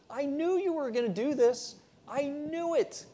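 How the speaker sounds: pouting I-told-you-so; whiny Jonah. Acted. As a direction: whine it like a sulking prophet. Jonah griping at God.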